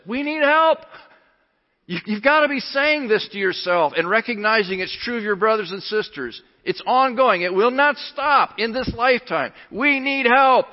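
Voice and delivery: high, loud preacher's plea; urgent pulpit cry; impassioned preaching. Real, not acted. Preaches at full force.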